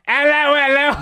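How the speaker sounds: put-on high Italian-accent hello hello; mock Italian accent. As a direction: greet the crowd in a hammy Italian accent. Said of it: "he's like".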